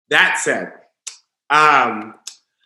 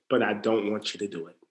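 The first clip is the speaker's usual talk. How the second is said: drops much softer and lower, quick; soft whisper; 9 semitones lower, 12 dB quieter, faster than usual. Retold or imitated. God's whisper telling a believer not to do it, softly. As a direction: hush it like God's quiet word. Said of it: "A whisper from God could say".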